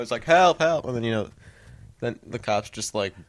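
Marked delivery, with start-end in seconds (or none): 0.2-0.8 high, loud pretend plea; mock crying for help. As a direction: cry for help as the imagined victim. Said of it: "he's crying on the floor".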